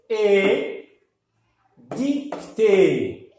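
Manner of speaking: "Et" held long and loud, then "dictée" pulled apart; syllable by syllable. Shown as a demonstration. Teacher demonstrates the sound to learners, "Et" and "dictée" drawn out.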